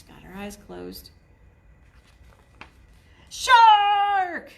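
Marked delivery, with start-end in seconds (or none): 3.3-4.5 loud high sudden yell; boy yells to scare sister; scary shout. A narrator's reading. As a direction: startle her with a shout. Scaring the volunteer.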